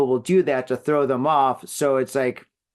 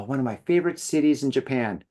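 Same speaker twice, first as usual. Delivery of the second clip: ordinary delivery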